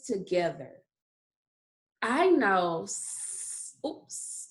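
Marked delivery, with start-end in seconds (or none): 2.8-3.8 a lone, held "s-"; hushed phonics "s-" on its own; isolated first sound. Shown as a demonstration. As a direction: say just the s sound, no vowel. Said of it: "that was the first sound".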